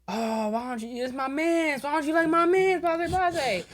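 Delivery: mock the cool kids' ribbing; lightly voiced teasing teens; cool kids. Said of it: "they were like".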